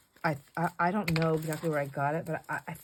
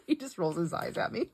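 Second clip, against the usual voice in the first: ordinary talk, nothing marked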